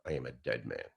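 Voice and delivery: low, hushed, measured retelling; soft, weighty and sinking in pitch; solemn. Retold or imitated. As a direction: imitate a friend's grave admission, voice dropping away. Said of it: "he looked at me solemnly".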